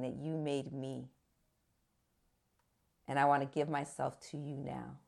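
low, soft prayer voice; retold sinner's prayer.

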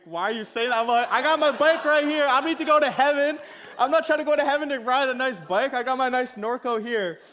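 loud, high, hammed-up outraged refusal; exaggerated indignant kid. Boy shouts at the pastor that he needs no heaven bikes, loud and high. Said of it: "I didn't say it like that".

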